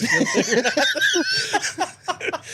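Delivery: laughing through it, high and slow. Real, not acted. Teasing [name] about his Smash Mouth knowledge, laughing. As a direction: giggle the words out.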